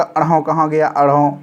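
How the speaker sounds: normal talking voice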